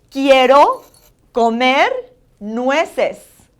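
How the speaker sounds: loud rising "Quiero", rising "comer", then "nueces"; teacher's emphatic "Quiero, comer, nueces"; accentuated Spanish vowels. Shown as a demonstration. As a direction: model "Quiero comer nueces" with pauses between words. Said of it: "vowels are very important that you accentuate".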